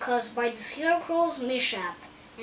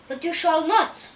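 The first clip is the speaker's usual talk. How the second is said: loud, raised refusal; challenging voice; 4 semitones higher, 7 dB louder than usual. A narrator's reading. Hill guard answers the Scarecrow, challenging refusal. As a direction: refuse them firmly, pitched up a little. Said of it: "replied the voice".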